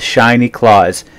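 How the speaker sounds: usual speaking voice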